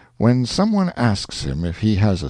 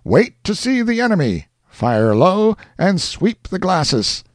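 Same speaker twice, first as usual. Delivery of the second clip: commanding orders; 11 semitones higher than usual. Acted. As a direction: order the men to hold fire. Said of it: "exclaimed a voice above them".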